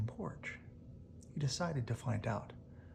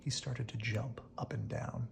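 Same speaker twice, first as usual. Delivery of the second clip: usual speaking voice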